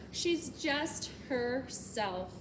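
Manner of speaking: usual speaking voice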